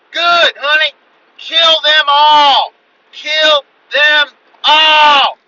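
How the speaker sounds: high, very loud drawn-out war cry; old lady screaming. Retold or imitated. Wife cheering on raccoon-shooting husband, long high scream. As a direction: scream encouragement, stretching each word. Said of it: "I hear the old lady at the house".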